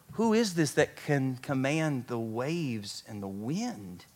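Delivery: slow, soft, low wonder; acted-out stunned disciples, hushed and drawn out; hushed awe. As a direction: ask it slowly in quiet amazement. Disciples awed after storm stills.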